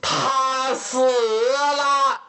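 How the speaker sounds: high, very slow, drawn-out wail; grief-stricken wail. A narrator's reading. Father hears his daughter is dead, anguished. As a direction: draw each word out in anguish.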